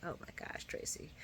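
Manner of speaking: breathless whispered sigh at Tracy; exasperated whisper. Real, not acted. Vlogger to viewers, fed up with Tracy: exasperated whisper. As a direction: mutter it under your breath in frustration.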